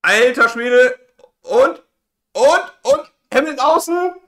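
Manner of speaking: high, tense and loud; excited shout. Real, not acted. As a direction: call the final lap, thrilled. Restart battle on track.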